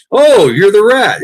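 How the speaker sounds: high, loud, slow taunt; spring the joke on the warden, drawn out; mocking gotcha.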